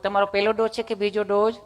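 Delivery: lightly voiced nurse, softened; nurse asking questions. Nurse questioning patient at vaccine centre, lightly imitated. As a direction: play a polite clinic nurse. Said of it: "nurse બેને પૂછવા".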